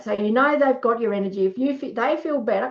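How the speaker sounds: ordinary talk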